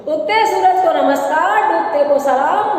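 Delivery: big sing-song showman's patter; magician's patter tone. Shown as a demonstration. Street magician greeting the crowd before the show, big patter tone. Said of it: "बड़ी tone यही होती है".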